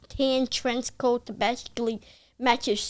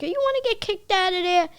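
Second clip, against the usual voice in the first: higher-pitched mock parental warning; alarmed parental scolding; 8 semitones higher than usual. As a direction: imitate an incredulous parent. Parents' alarmed reply, acted.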